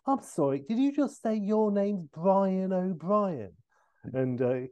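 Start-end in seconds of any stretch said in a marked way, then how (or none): 0.1-3.5 Austin Powers. Acted. Austin pausing the fight to query the name, incredulous. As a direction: stretch the name out in disbelief. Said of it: "And he's like".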